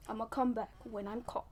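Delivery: normal talking voice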